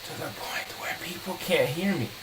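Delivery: hushed whisper, barely audible. Shown as a demonstration. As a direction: drop to a whisper so nobody far off can hear. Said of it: "I would lower my voice".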